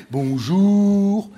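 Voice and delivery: put-on slow, prim cabin-crew welcome with no regional accent; slow, refined flight-attendant welcome; polished steward greeting. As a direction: put on the proper airline voice. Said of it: "j'ai gommé mon accent, je parle bien, je fais".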